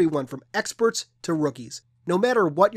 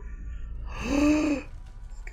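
excited gasp; 11 semitones higher, 5 dB quieter than usual. Real, not acted. Sees game reveal.

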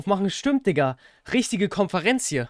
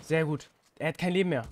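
ordinary talk, nothing marked

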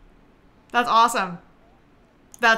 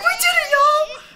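high, loud victory shout; shouted mock triumph; excited cheer; 9 dB louder than usual. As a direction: cheer it like you just won. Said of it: "I was like".

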